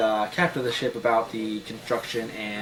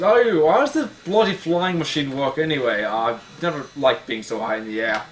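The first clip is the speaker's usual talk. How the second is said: a mild Scottish dwarf brogue, a touch louder; Scottish dwarf accent; 2 semitones higher, 4 dB louder than usual. Acted. Player doing the dwarf accent for the GM, Scottish brogue. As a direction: put on a rough Scottish dwarf voice. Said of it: "let's see that example dwarf accent".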